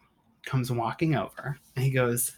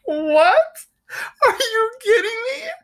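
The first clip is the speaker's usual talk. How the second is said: high, loud laughing disbelief; high laughing are you kidding me; shocked disbelief; 6 dB louder than usual. Retold or imitated. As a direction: squeal it out in disbelief. Records ruined by mold.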